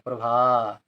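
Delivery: प्रभा with the final aa held; long vowel. Shown as a demonstration. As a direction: linger on the end of प्रभा. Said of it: "भा देर तक बोला जा रहा है".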